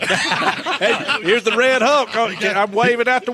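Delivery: high, loud, laughing greeting. Real, not acted. [name] greeting the coach through laughter. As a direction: crack up while calling out.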